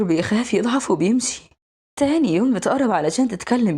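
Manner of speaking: ordinary delivery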